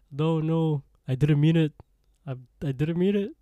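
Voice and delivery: hammed-up frantic apology; pleading, mock frightened. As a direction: act out backing away in fear. Captain begs while backing away.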